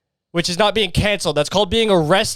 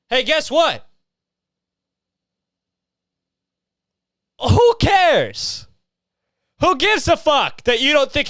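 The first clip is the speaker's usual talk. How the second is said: sarcastic who cares; 6 semitones higher, slower than usual. Real, not acted. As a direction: scoff at them, pitch jumping up. Ranting sarcastic rebuttal to critics.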